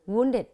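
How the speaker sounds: Wounded with a loud first syllable, falling after; first-syllable stress. Shown as a demonstration. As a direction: hit the start of Wounded hard. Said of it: "nhấn ở âm tiết thứ nhất".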